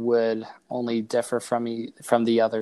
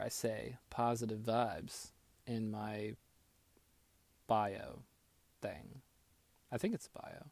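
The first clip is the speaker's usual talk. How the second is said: soft whisper; 15 dB quieter, slower than usual. Real, not acted. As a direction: breathe the words out. Whispered aside.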